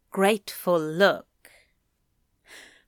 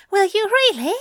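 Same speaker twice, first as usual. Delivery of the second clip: much higher, louder thrilled angel; excited; 12 semitones higher, 5 dB louder than usual. Acted. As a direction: gasp it out with delight. Aziraphale excited.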